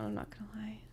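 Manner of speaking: a breathless whispered admission; whispered confession. Acted. Friend on podcast confessing a crush in a whisper.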